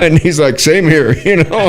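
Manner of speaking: loud, high, talking through laughter; big laugh spilling over the words. Real, not acted. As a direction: deliver the punchline while cracking up. Laughing at the officer's comeback.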